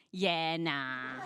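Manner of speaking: mock Australian drawl, a bit of a laugh in it; Australian accent. Comic doing Australians for the crowd, Australian accent. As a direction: toss it off like a laid-back Aussie. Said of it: "But Australians say".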